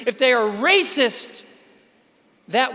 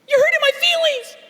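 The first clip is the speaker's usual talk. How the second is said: high whiny victim voice, much higher; mock whine; 3 dB louder than usual. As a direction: whimper it like a hurt kid. Victim whining to bully.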